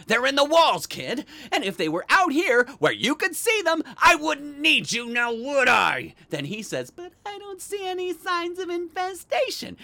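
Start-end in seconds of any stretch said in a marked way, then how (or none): 0.1-6.1 loud, high-pitched indignant retort; irate, talking down to the kid; exasperated retort. Retold or imitated. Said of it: "I told him". 6.9-9.8 high, whiny parroting voice; mocking singsong in a very high voice; mocking exterminator kid. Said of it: "Kid was like a broken record".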